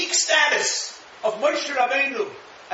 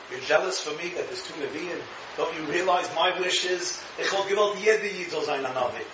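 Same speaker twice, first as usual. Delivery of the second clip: gentle majestic Moshe; 5 semitones lower than usual. Retold or imitated. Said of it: "Moshe in his full majesty".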